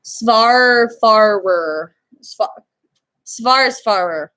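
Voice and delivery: "Svarfarer" slowly, opening on s-v instead of sh-w; careful pronunciation attempt. Shown as a demonstration. Apologizing for butchering a foreign word, careful pronunciation attempt. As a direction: draw out "Svarfarer" with an s-v start. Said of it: "I apologize if I'm butchering that word".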